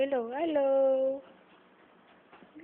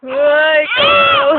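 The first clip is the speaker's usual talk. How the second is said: much higher, much louder teasing call; loud playful call; 6 semitones higher, 17 dB louder, much slower than usual. Real, not acted. Calling out to baby. (malakas na tawag)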